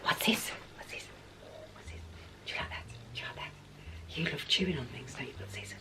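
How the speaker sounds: soft whispered questions to the dog; quiet whispered pet talk. Real, not acted. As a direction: hush it, close and gentle. Whispering to dog while playing.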